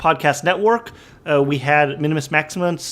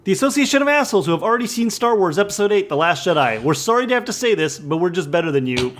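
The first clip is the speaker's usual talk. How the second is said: mock ad read; 4 semitones higher than usual. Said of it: "who's a sponsor this week?".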